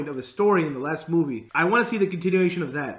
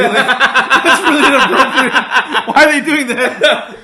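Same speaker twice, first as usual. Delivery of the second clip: loud, slow, higher, through laughter; louder laughing disbelief at the film; laughing outrage; 8 semitones higher, 13 dB louder, slower than usual. Real, not acted. Laughing outrage at casting choice.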